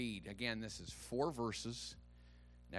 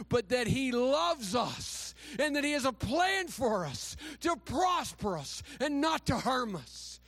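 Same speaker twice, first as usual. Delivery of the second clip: louder, higher impassioned preaching with shouted peaks; preaching at full force; 10 semitones higher, 6 dB louder than usual. Real, not acted. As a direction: fire up the church. Preaching climax to congregation.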